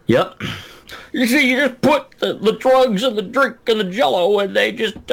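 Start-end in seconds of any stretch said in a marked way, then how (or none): 1.1-5.1 high-pitched rambling comic impression; Bill Cosby. Podcaster riffing on a spiked-drink joke, mock Cosby voice. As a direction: put on a hammed-up celebrity voice. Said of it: "instead of channel Cosby".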